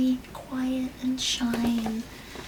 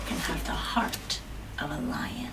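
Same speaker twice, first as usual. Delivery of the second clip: whisper; 5 semitones lower, 9 dB quieter than usual. Acted. Dramatic reading of blurb.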